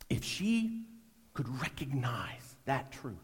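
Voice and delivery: breathy hushed whisper. Real, not acted. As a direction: drop to a whisper mid-thought. Hushed aside in sermon.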